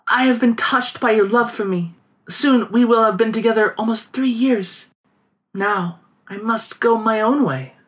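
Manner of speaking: put-on high, gentle young woman. A narrator's reading. Voiced girl bidding farewell.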